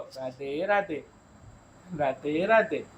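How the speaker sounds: put-on hushed slow greeting modelled for a child; soft demo. Shown as a demonstration. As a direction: say it gently so the child copies. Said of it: "આ બોલો".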